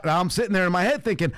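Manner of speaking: usual speaking voice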